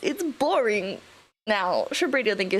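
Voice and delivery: ordinary talk, nothing marked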